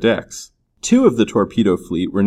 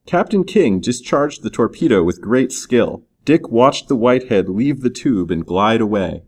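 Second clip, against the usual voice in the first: usual speaking voice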